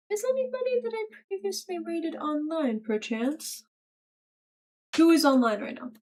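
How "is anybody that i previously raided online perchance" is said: high, very soft question; dainty put-on voice. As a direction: ask it softly in a high put-on voice.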